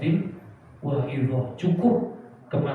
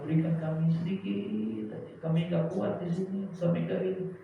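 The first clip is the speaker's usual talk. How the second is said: high, soft begging voice; pleading hell dwellers; 4 semitones higher, 5 dB quieter than usual. Acted. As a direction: beg weakly for a little water. Voicing pleading people of hell. (memohon lirih dengan suara tinggi)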